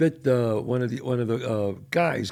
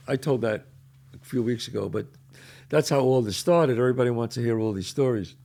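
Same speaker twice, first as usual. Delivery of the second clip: ordinary talk, nothing marked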